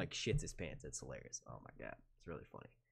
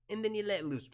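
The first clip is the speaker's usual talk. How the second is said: higher comic voice, easing down; movie character impression; 8 semitones higher than usual. Streamer quoting a movie for chat, releasing comic voice. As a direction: release the squeeze, letting pitch fall. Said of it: "Who knows what this is a reference to?".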